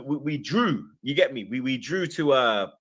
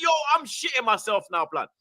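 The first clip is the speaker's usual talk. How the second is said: higher, a touch of retold panic; mock panic; 9 semitones higher than usual. Telling his wife about the viral video, mild mock panic. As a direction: sound rattled as the numbers climb. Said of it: "I'm getting paranoid".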